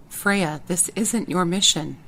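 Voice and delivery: usual speaking voice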